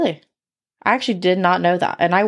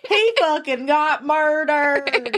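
exaggerated Southern accent; 4 semitones higher, much slower than usual. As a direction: stretch it into a hammed-up Southern drawl. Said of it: "stop with the accent".

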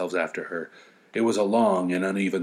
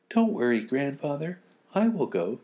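put-on higher, eager little girl; 7 semitones higher than usual. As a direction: play the brave granddaughter. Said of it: "his little granddaughter said".

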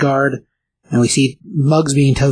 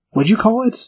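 high sharp question; 9 semitones higher than usual. Retold or imitated. Thor coldly questions Volstagg.

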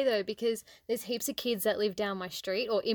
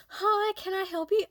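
chirpy shop assistant; 9 semitones higher than usual. Retold or imitated. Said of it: "someone comes up to me and goes".

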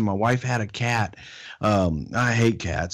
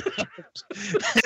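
high burst of laughter; 4 dB quieter than usual. Real, not acted. Laughing at the memory of Freddie's line. Laughing after acted quote.

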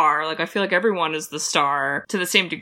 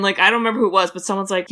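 ordinary talk

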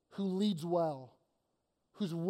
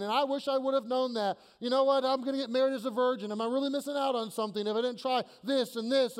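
higher and louder, urging the listeners; impassioned exhortation; 4 semitones higher, 5 dB louder than usual. Real, not acted. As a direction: plead hard with the congregation not to mope. Preacher exhorts congregation passionately.

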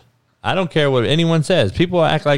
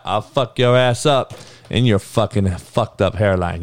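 usual speaking voice

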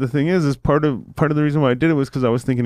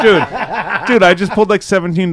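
high, loud words pushed out through laughter; laughing hard while getting the words out; 8 semitones higher, 7 dB louder than usual. Real, not acted. Laughing while retelling the story.

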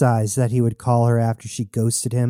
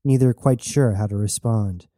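ordinary delivery

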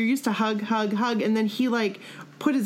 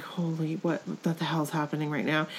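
stunned hush; 4 semitones lower, 6 dB quieter than usual. Retold or imitated. Stunned at being asked for hug.

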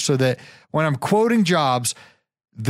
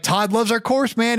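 retelling an excited friend, a touch higher; lightly mimicking John's excitement; 7 semitones higher than usual. Host retelling John's rave about the course, mildly excited. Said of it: "He's like".

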